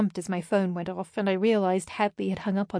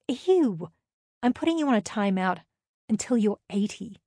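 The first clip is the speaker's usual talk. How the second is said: ordinary delivery, nothing marked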